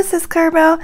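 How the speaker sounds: usual speaking voice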